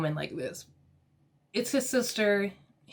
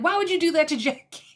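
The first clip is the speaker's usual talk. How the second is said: high, louder, rushed mock outrage; mock-appalled quote, pitched up; retold confrontation; 7 semitones higher, 5 dB louder, much faster than usual. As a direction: act out an indignant demand, fast and raised. Retelling Margaret confronting the other.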